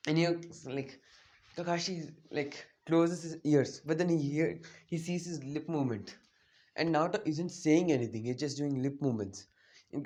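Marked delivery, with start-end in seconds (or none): none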